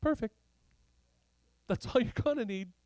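high, light, upbeat reply; pleased approval. Acted. God reassuring the teenage shepherd, high pleased voice.

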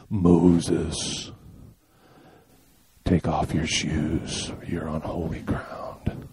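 low, slow, fading into a whisper; deep divine voice sinking to a hushed whisper; Val Kilmer as God. Retold or imitated. Pastor doing Val Kilmer's God telling Moses, low and slow. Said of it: "my best Val Kilmer".

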